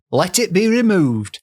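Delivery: high, imploring mock-Yorkshire voice; theatrical Yorkshire plea; put-on Yorkshire accent. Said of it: "she's from Yorkshire".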